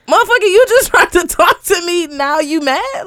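high, loud mock outrage; retold comeback, loud and incredulous; indignant retort. Woman firing back at the rejected man who insulted her, indignant.

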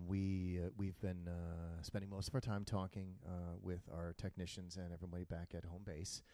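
low, quiet captain announcement; calm airline captain on the intercom; pilot voice. Retold or imitated. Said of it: "And he's like".

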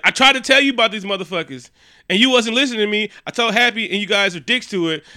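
a hammed-up teen scolding his mentor; mock angry rant. Spider-Man yelling at Iron Man.

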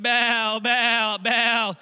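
high, loud repeated chant; loud mock crowd cry; acted shouting. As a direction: shout it like worshippers calling their god. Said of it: "they're shouting".

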